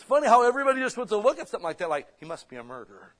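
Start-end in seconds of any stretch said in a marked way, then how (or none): 2.2-3.1 imitated low, soft gossip; mock hushed accusation, pitched down; retold whisper. Onlookers jumping to conclusions about the bitten man, retold whisper.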